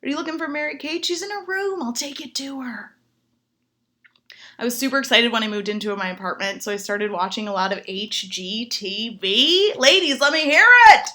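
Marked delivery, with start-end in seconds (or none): 0.0-3.0 much higher, eager dog voice; friendly dog voice. Retold or imitated. The dog greeting the killer in a high eager voice. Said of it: "That dog would be like". 9.8-11.1 much louder call to the ladies; rally the room; shout to crowd. Real, not acted. To the ladies in the audience, a loud rallying shout.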